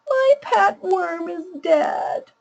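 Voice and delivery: put-on a slow, high wail of a child. Shown as a demonstration. Educator voicing the child's dead worm, high and slow. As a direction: cry it out like a small child, high. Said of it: "I'll use a really high voice to read the child's problem".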